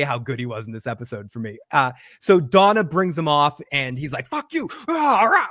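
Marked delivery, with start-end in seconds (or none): 4.3-4.7 high-pitched retold curse; mock fury. Host acting out [name] storming off, high mock-furious curse. Acts [name]'s furious outburst. 4.9-5.5 high, loud mock scream; imitated scream. Host imitating [name]'s scream on the stairs, loud high roar. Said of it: "he does this scream".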